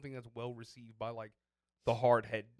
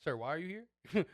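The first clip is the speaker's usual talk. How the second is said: slow, louder, higher mock challenge; pointed, drawn-out mock demand; retold annoyance; 5 semitones higher, 6 dB louder, slower than usual. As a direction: act out being mad at the instructor. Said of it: "looking at the instructor mad".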